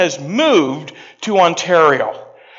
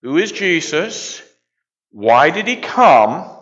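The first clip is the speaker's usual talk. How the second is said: louder and slower, leading the crowd; call and response; 3 semitones higher, 4 dB louder than usual. Shown as a demonstration. Pastor prompting a hesitant congregation, louder call. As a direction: punch each question so they echo it. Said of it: "Say them with me".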